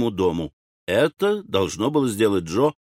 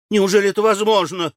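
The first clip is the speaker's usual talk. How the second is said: high, loud, quick disbelief; растерянно; 10 semitones higher, 5 dB louder, faster than usual. A narrator's reading. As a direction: gasp the question out in amazement. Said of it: "совсем растерялась". (растерянно, высоко и быстро)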